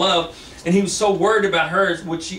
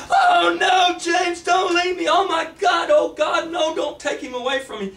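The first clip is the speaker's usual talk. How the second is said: desperate pleading shout; 11 semitones higher than usual. Acted. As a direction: scream for him as he fades. Shanna begs James not to leave.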